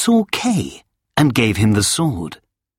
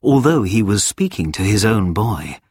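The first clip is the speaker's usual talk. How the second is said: usual speaking voice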